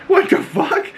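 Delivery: high squeaky burst of astonishment; shocked disbelief. Retold or imitated. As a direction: exclaim it way up high, utterly baffled. Acts out the viewers' shocked reaction.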